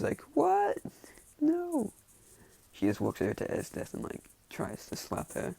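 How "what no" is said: high-pitched shocked squeal; acted high-voiced indignant refusal; shocked reaction. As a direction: squeak it out in disbelief.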